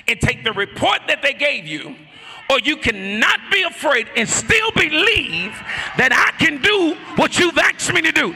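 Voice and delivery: loud, high preaching cry; full-force preaching. Acted. Preacher urging the congregation at full force.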